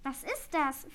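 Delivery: ordinary delivery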